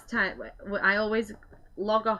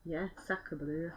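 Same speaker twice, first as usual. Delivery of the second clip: bad French accent; 2 semitones lower, 10 dB quieter, slower than usual. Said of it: "that were really Barnsley, weren't it?".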